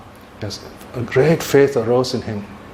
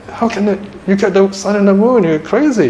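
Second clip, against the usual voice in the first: acted incredulity; 8 semitones higher than usual. Father answers the boy who wants sun and moon, in acted high-pitched disbelief. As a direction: play a father scoffing at an impossible request. Father scoffs at impossible request.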